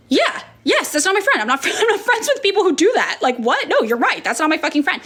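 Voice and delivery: high, laughing disbelief; shocked agreement. Retold or imitated. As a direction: squeal it out, half laughing in shock. Retelling her shocked reply to bartender.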